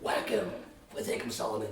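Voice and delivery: hushed mobster giving an order; mafia guy. Acted. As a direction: quietly play a dying mob boss. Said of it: "like the mafia guy".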